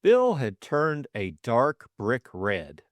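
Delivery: ordinary delivery, nothing marked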